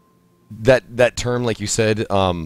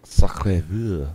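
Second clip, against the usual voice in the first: put-on French accent; 2 semitones lower, slower than usual. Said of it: "I love practicing accents".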